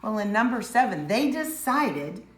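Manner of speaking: ordinary talk, nothing marked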